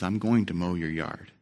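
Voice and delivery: steady and gentle, won't be shaken; quietly firm old neighbour; calm old man. Retold or imitated.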